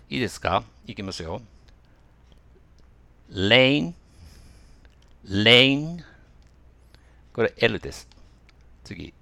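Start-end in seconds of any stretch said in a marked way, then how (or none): 3.3-6.0 lane said twice with a clear tongue-tip L and rising end; L quiz word. Shown as a demonstration. Teacher quizzing students on L or R. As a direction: give the L sound for guessing. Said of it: "Rだったのか当ててください".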